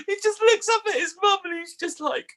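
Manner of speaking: very high squeal of laughter through words; helpless laughter. Real, not acted. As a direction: barely get the punchline out. Cracks up at the punchline.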